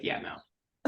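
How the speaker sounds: whispered, dry "yeah, no"; deadpan bartender refusal. Retold or imitated. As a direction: shut her down quietly and dryly. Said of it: "and he was like".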